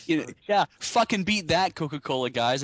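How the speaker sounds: taunting the Coca-Cola guys after the logo trick; triumphant taunt. Real, not acted. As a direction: crow at your rivals. Mock-challenging rival brand.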